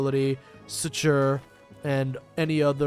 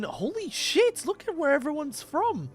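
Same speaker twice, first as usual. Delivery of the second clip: amazed; 12 semitones higher than usual. Real, not acted. Amazed reading chat.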